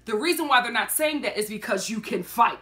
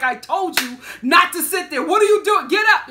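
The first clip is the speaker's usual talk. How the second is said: loud, rising mock yell; retold yelling; 6 semitones higher, 9 dB louder than usual. Man yelling at seated lady, retold as rising shout. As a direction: build to a high, loud imitated shout. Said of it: "he starts yelling at the lady".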